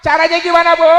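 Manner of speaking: high, loud shouted question; rousing preacher's call; calling to the crowd. Real, not acted. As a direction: throw the question out to the whole hall. Calling out to the audience.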